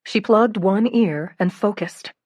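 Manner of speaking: ordinary delivery, nothing marked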